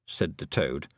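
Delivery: ordinary delivery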